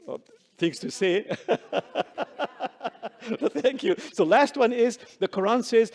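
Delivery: chuckling while talking, loud; laughing at running out of time. Real, not acted. Laughing through closing, over time.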